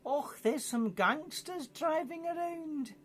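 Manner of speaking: hammed-up Scottish granny accent, high and slow. Dundee granny calling the police, mock Scottish accent. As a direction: camp Scottish old lady, drawn out up high. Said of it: "probably with a Scottish accent".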